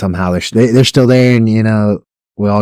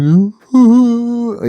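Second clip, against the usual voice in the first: panicked yelps; 14 semitones higher, much slower than usual. Retold or imitated. Kid freaking out again.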